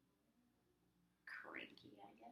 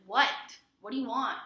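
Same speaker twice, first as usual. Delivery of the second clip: louder, higher irritated retort; aggressive snap; 6 semitones higher, 13 dB louder than usual. Retold or imitated. Teen snapping at friend calling Logan, irritated louder retort. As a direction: snap at a friend who interrupts. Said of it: "that came off really aggressively".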